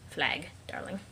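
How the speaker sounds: whispered aside; whispered aside to the game. Acted.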